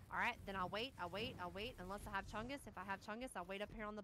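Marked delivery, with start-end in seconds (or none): none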